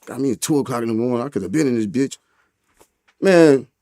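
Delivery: ordinary talk, nothing marked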